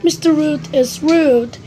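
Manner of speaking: ordinary delivery, nothing marked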